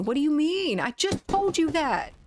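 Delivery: high-pitched, laughing protest; playful exasperation. Real, not acted. Streamer telling chat they can't do accents, playfully exasperated. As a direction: protest in amused disbelief, voice shooting up.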